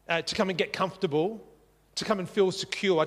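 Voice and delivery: ordinary talk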